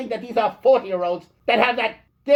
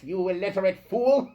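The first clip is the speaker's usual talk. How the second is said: ordinary talk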